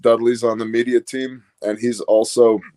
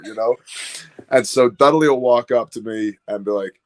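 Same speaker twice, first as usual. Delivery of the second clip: ordinary talk, nothing marked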